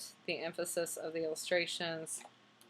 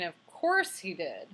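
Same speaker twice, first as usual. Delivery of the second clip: ordinary talk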